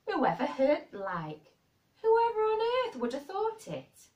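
loud Yorkshire exclamation soaring very high; astonished broad Yorkshire. A narrator's reading. Housekeeper with no one to hear her, astonished broad Yorkshire. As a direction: cry out amazed in broad Yorkshire. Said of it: "speaking rather broad Yorkshire herself".

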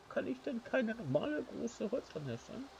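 usual speaking voice

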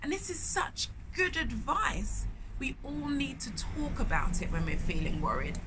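ordinary delivery

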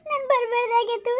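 crying and wailing with regret; tearful lament. Acted. Woman alone regretting she trusted her lover, crying. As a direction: lament aloud through sobs. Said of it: "ಇನ್ನೂ ಅಳ್ತಾ ಕುಂತವಳೆ".

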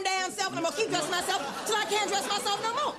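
fast, shouted tirade; indignant rant. Acted. Customer rants at clerk's suggestion.

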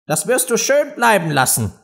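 high, firm prohibition; cross mouse. A narrator's reading. Mouse forbids Alice before walking off, cross.

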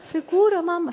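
put-on child doubting mother's promise of ice cream; suspicious child. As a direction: ask like a kid smelling a trap. Suspicious kid.

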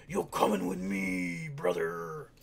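low, loud wrestler-style threat; growling tough guy. Pretend. Gamer imagining grabbing a rival, low tough-guy voice. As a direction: drag it down deep and menacing. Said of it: "Should've been like".

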